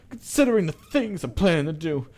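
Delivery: high, loud start dropping to a quick threat; acted hateful threat; boiling hatred. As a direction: warn them off with seething hatred. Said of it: "voice throbs with pure, boiling hatred".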